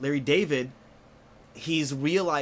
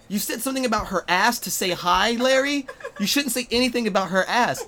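higher, raised voice, telling him off; mock scolding wife; 7 semitones higher, 4 dB louder than usual. Comedian as Cheryl scolding Larry, mock telling-off.

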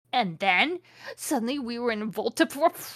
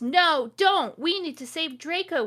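high-pitched, hammed-up plea; begging; 8 semitones higher than usual. A narrator's reading. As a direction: plead urgently, voice pitched way up. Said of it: "we begged".

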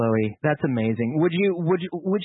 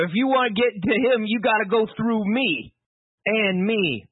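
higher-pitched New York movie line; New Yorker accent; 6 semitones higher, slower than usual. Acted. Host setting up the powerful moment, New Yorker accent. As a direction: play a defiant New Yorker, drawing out the challenge. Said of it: "he's a New Yorker".

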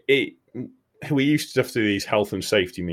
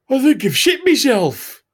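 sheepish confession; 7 dB louder than usual. Retold or imitated. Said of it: "and goes".

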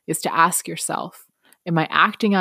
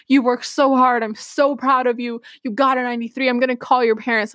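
higher, gushing teacher voice; proud teacher; 7 semitones higher than usual. Retold or imitated. Teacher praising the student, in a higher gushing voice. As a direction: pour out the praise. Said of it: "She's like".

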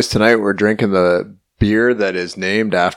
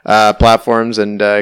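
normal talking voice

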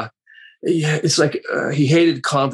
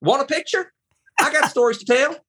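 much higher, sassy Southern lady voice; 13 semitones higher than usual. Retold or imitated. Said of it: "there's always [name]".

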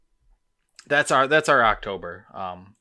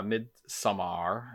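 Midsommar stretched out, Swedish-style, rising on "mar"; mock Swedish; 6 dB quieter, much slower than usual. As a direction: slowly echo a co-host's put-on Swedish pronunciation. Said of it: "as Brandon so eloquently said".

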